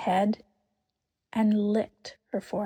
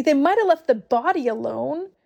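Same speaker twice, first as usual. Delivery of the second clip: higher, louder grieving plea; tearful; 5 semitones higher, 5 dB louder than usual. A narrator's reading. Lucy weeps that they took the body, crying. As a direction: still crying, pleading. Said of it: "sobbed Lucy".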